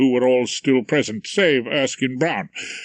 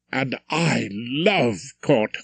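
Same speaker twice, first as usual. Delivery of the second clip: slow, lightly enthusiastic; a touch of eagerness, drawn out; much slower than usual. A narrator's reading. Barrister's wife tells Rumpole she loves court, mildly enthusiastic. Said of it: "his wife was enthusiastic".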